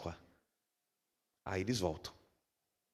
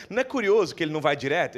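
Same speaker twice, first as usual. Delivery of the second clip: normal talking voice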